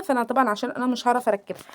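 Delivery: normal talking voice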